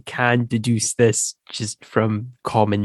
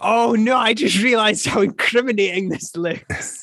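high-pitched mock alarm; comic dismay; 10 semitones higher than usual. Newcomer realizing this looks incriminating, comic dismay. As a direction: squeak out a sheepish, laughing realization.

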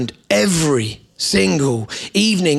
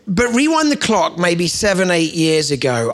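normal talking voice

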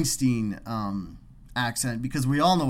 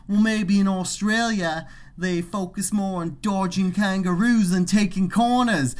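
hammed-up, higher-pitched Aussie; mock Australian accent; 5 semitones higher than usual. Worker mocking trucker to coworker, put-on Australian accent. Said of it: "we're making fun of his accent".

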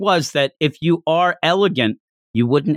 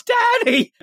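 put-on child's cry. Child cries out after father.

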